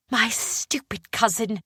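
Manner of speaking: put-on high, slow, frustrated girl; stamping-foot annoyed young woman; Willa's voice. A narrator's reading. Willa frustrated after falling.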